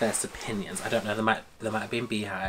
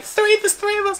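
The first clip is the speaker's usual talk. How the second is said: very high, louder squeal; squealing excitement; 8 dB louder than usual. Retold or imitated. Fan answering the guard giving wristbands, squealing. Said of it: "I was like".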